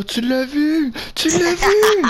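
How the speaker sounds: builds to a high cracking cry; rising disbelief. Real, not acted.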